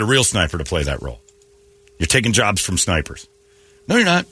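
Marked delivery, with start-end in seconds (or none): none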